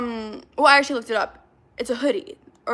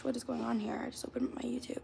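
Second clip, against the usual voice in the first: whisper; 13 dB quieter than usual. Real, not acted. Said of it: "whispered aside".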